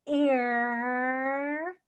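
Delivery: long "ear", gliding from ee into a held r; smile, then pull the tongue back through "ear"; stretched ear sound. Shown as a demonstration. Coach drilling the ear sound, stretched "ear". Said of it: "It goes".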